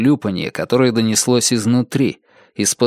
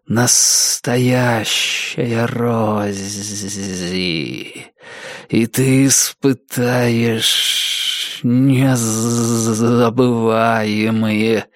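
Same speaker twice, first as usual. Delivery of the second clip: very slow, eerie luring whisper; disembodied voice beckoning, drawn out; pleased temple whisper; much slower than usual. A narrator's reading. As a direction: stretch the vowels and let the consonants linger. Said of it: "В нём явственно слышались довольные нотки".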